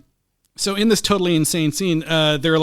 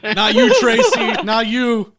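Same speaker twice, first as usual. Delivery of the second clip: louder, laughing brush-off; mock quarterback; 7 semitones higher, 6 dB louder, slower than usual. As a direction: wave off the wrong girl. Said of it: "He points at her like".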